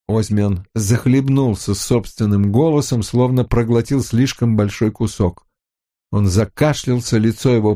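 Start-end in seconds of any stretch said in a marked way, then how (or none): none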